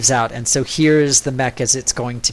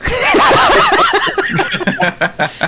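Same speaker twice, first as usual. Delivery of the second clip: loud laugh; 6 dB louder than usual. Real, not acted. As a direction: burst of high laughter. Big laugh at table joke.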